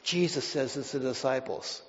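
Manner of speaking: ordinary delivery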